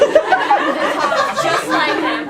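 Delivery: ordinary delivery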